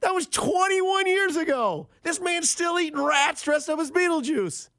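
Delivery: incredulous rant. Real, not acted. Host amazed rat man still works there, incredulous high rant. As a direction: pitch way up, stretching the words in outrage.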